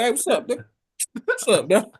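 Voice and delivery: mock laid-back greeting; casual greeting. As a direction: greet Elon like an old friend. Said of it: "I'll be like".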